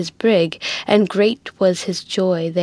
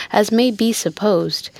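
usual speaking voice

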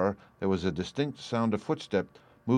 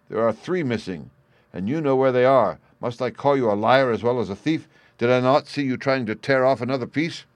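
loud, higher, building tirade; furious accusation; 5 semitones higher, 6 dB louder than usual. A narrator's reading. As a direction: hammer him with angry questions. Raging father accuses his son.

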